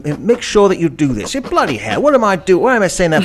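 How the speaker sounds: mock British comic actor patter; hammed-up, chipper British delivery; British accent. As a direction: put on a jokey British voice. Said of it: "all these British actors".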